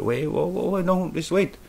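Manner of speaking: high, urgent alarm, calling her back. Retold or imitated. Narrator's retold dream, calling after a woman, high alarmed plea. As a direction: stop her before she goes in.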